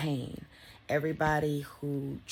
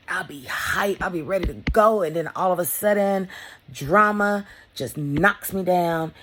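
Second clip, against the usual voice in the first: higher and louder, rushing, then slowing hard; excited build; 5 semitones higher, 8 dB louder than usual. Real, not acted. Describing her hype to viewers, rising and loud. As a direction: swell with energy then get knocked flat.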